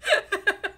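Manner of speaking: loud amused burst of laughter. Real, not acted. Laughs at the odd cut.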